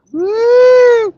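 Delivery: high loud siren woo imitation; siren whoop. Imitating a Canadian siren for friends, high loud woo. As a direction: mimic a siren wailing up high. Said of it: "Canadian siren because it goes".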